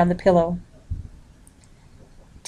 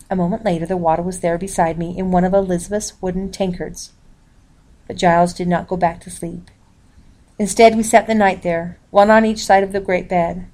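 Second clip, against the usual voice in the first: plain reading voice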